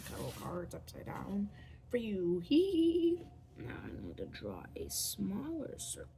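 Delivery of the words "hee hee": high, loud playful "hee hee"; silly hee-hee squeal. Pretend. Vlogger amusing themself while drawing, silly high squeal. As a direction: squeal it out as a goofy noise.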